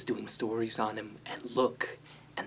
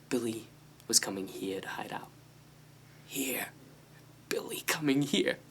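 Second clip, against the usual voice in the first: normal talking voice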